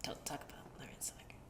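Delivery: quick, whispered aside; hushed warning. Pretend. Host warns co-host off a taboo fighter, hushed whisper. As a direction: whisper it fast like a forbidden subject.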